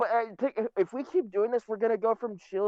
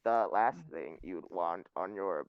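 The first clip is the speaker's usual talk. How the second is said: robot voice; 11 semitones lower, 6 dB quieter than usual. Retold or imitated. Meme narration continued.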